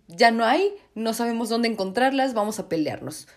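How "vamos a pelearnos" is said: ordinary talk, nothing marked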